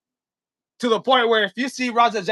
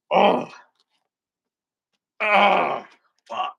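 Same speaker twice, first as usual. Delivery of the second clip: pained groan; much slower than usual. Real, not acted. Hits himself with can.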